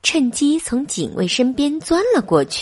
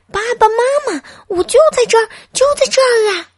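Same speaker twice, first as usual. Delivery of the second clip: put-on much higher, louder child's yell; desperate shout; 6 dB louder, faster than usual. A narrator's reading. Boy yelling to unseen parents, desperate shout. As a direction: beg them to notice you. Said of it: "小东尼大叫".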